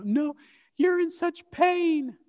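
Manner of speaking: a hint of the worried wife pleading; wife's worried protest. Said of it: "Amanda's reply in the retold dialogue".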